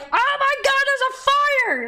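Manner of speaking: high, loud fake alarm; mock panic. Streamer mocking the silent crowd, high panicked yell. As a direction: shriek it like a cartoon bystander. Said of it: "no one's like".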